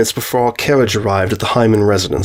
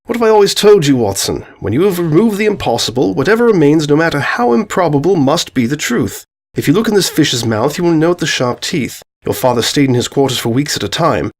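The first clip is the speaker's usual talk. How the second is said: Holmes expounding his deduction, livelier and higher; confident detective's explanation; 3 semitones higher than usual. Acted. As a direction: lay out the solution with emphatic assurance. Holmes lectures on the solution.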